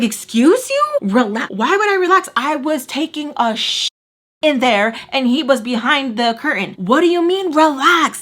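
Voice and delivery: high, loud, indignant retort; mad. Retold or imitated. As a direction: throw it back at him, outraged. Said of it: "So, like, I'm mad".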